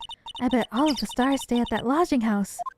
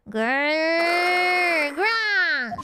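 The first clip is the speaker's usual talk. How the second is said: rolled growl climbing higher; tiger growl; 5 semitones higher than usual. Acted. Tiger appears before Maya and Nick, growling.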